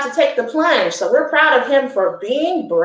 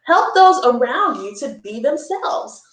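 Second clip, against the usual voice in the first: ordinary talk, nothing marked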